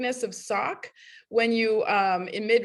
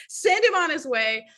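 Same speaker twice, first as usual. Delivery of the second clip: ordinary talk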